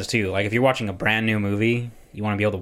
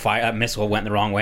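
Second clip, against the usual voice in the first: usual speaking voice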